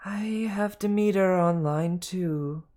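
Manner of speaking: high slow female snake; Martha the snake. Acted. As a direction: announce the call like a receptionist. Martha announcing a call.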